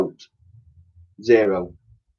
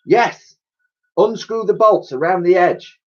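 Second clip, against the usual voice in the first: commanding professor; 8 semitones higher, 3 dB louder than usual. Acted. Professor gives orders.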